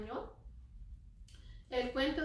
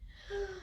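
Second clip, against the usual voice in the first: mock gasp; 8 semitones higher, 6 dB quieter than usual. Surprise at puppet.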